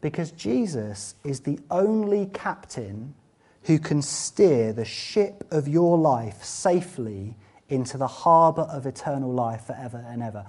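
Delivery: ordinary talk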